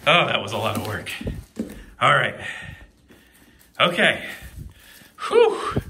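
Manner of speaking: panting out of breath after effort; breathless and winded. Real, not acted. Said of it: "I'm out of breath".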